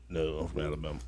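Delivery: imitating his own slow, low answer; slowed-down reply. Telling a new acquaintance where he's from, slowed-down reply. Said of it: "If I slow it down and say it like that".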